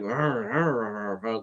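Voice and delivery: garbled, slurred "arr" noises; mock incomprehensible mumble; imitated unintelligible accent growl. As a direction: growl out mushy r-sounds as mock backwoods speech. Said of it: "it just was like".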